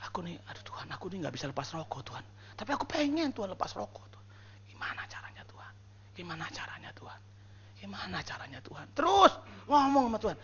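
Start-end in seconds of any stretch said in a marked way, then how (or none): 0.1-8.9 hushed, helpless confession to God; soft, breath-only pleading; whispered plea. Shown as a demonstration. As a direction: whisper a struggling, private prayer. Models a smoker's private plea to God.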